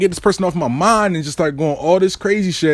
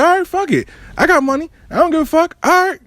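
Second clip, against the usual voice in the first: high, pitched-up fed-up exclamation; exasperated outburst; 11 semitones higher than usual. Retold or imitated. To girlfriend dropping him off, high exasperated outburst. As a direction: throw up your hands, voice jumping high.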